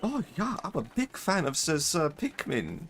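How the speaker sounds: high-pitched mock German accent; put-on German voice, high and delighted; Ray Robinstein. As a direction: gush like a hammed-up German fan. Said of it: "that was my impression of Ray Robinstein".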